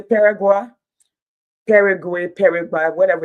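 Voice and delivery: loud teasing call-out. Real, not acted. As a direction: call the team out across the room. Calling out to rival team.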